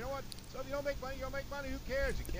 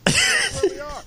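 much higher, much louder, furious; real angry shout; 14 dB louder, slower than usual. Real, not acted. Host telling everyone he is pissed off, furious shout. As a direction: scream it, fed up. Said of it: "I'm pissed off".

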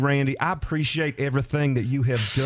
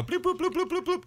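squeaky bloops; 4 dB quieter than usual. Pretend. Playful counting-out sound.

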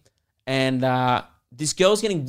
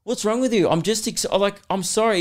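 retold exasperated plea; 7 semitones higher than usual. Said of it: "He starts going".

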